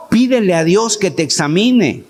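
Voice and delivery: ordinary delivery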